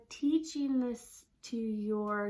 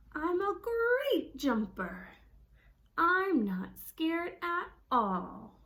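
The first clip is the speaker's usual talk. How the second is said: slow, louder, proud and sure of himself; confident; 5 semitones higher, 4 dB louder, much slower than usual. Shown as a demonstration. Boy convincing himself and his dad, confident. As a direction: sell it like he's convincing himself. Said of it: "he's trying to sound confident".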